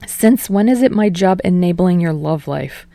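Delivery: ordinary delivery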